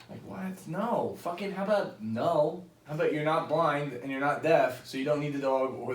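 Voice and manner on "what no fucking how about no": ordinary delivery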